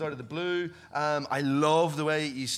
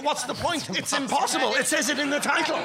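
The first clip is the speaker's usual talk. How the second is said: higher, louder mock pessimist; defeatist naysayer; 9 semitones higher, 5 dB louder than usual. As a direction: scoff at the idea as hopeless, hammed up. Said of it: "anyone else would say".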